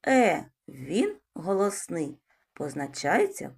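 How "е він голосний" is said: ordinary talk, nothing marked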